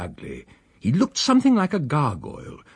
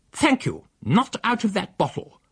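much higher, haughty lady's voice; prim refusal; 12 semitones higher than usual. Acted. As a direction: decline it with sniffy superiority. The female refuses.

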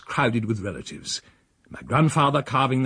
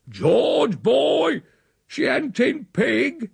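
high-pitched drawn-out holler; bawling; 13 semitones higher, 3 dB louder, much slower than usual. A narrator's reading. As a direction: bawl a question across the yard. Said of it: "and then bawled".

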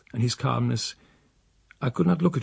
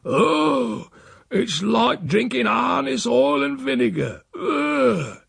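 put-on much higher, whiny disgusted old man; complaining; 11 semitones higher, slower than usual. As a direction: moan at the foul medicine.

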